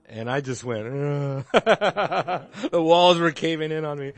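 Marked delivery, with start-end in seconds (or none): 0.9-1.5 short loud deflated groan; stunned groan. Retold or imitated. To the Witness who outquoted him, a beaten groan. Retold being stunned by reply. 1.5-4.1 loud, laughing through the words. Real, not acted. Telling the class his story, laughing loudly.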